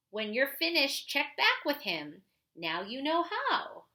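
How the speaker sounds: put-on clear, lively read-aloud voice for kids; reading a picture book aloud. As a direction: read it like a story for the class. Reads a children's book aloud.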